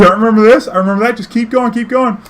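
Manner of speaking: put-on high, eager old woman urging him on; high excited old lady pointing the way; old lady passenger. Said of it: "She's like".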